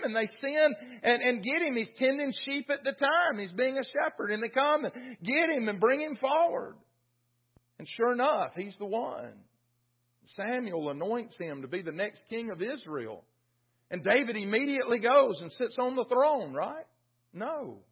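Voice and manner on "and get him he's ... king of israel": ordinary talk, nothing marked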